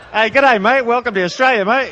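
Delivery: hammed-up Australian welcome; mock Australian greeting. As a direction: play a cheery Australian customs man, a bit hammed. Imitating Australian customs greeting.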